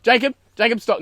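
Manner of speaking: loud call to stop. Real, not acted. Interrupting Jacob's joke setup, loud call. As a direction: cut him off loudly. Stopping friend mid-story.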